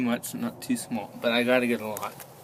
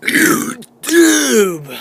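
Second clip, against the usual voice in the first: burping the words; 10 semitones higher, 11 dB louder than usual. Real, not acted. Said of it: "Oh man, it failed".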